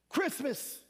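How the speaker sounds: ordinary talk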